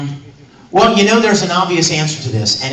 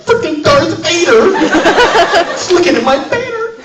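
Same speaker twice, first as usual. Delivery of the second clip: high, giddy fanboy freak-out; nerding out; 12 semitones higher, 5 dB louder than usual. Retold or imitated. Voice actor reliving spotting Darth Vader at a convention, giddy nerding out. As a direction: squeal it like a starstruck fan. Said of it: "I'm kinda nerding out".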